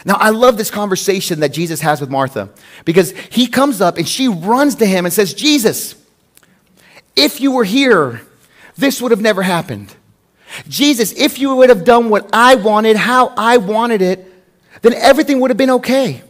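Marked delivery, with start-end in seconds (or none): 5.3-16.2 anguished reproach. Acted. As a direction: act out a grieving plea, stressing the blame. Acting out Martha's reproach to Jesus.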